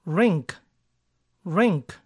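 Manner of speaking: "rink" with no vowel between r and i, short ɪ; rink demo. Shown as a demonstration. Teacher models the correct English word, careful. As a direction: say "rink" with no hidden u. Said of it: "Y se pronuncia".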